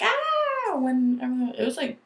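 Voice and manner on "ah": a much higher, short "Ah!" copying the fencers; a quick imitated shriek; mock scream. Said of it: "legit screaming, like".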